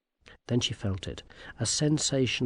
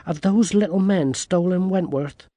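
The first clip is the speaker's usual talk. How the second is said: put-on higher, louder girl's question; lightly voiced anxious girl; 7 semitones higher, 5 dB louder than usual. A narrator's reading. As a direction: press the question as a worried girl.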